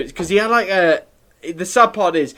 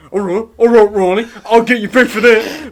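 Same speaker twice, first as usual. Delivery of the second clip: higher, louder mock Southampton accent, amused; imitated Southampton teacher; 5 semitones higher, 4 dB louder than usual. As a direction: play the teacher retorting in broad Southampton. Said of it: "he turned around one time and went".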